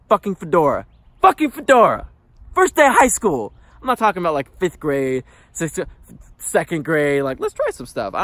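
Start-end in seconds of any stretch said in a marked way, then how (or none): 0.1-0.9 louder, disbelieving "fucking fedora"; rising exasperation at "fucking fedora"; exasperated repeat. Real, not acted. Recalling his outfit, he rants with mounting disbelief. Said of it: "I come out with a fedora". 1.2-3.5 "fucking fedora" shouted much higher and louder; exasperated yell. Real, not acted. Recalling his outfit, he yells in exasperated disbelief. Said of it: "I come out with a fedora".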